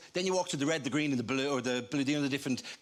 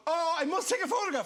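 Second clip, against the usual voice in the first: mock tourist; 13 semitones higher, 3 dB louder than usual. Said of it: "People keep going".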